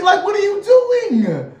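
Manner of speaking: high, slow, shouted outrage; indignant shout. Acted. Twenty-two year old yelling at kids, mock-offended shout. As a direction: throw the question out in mock outrage.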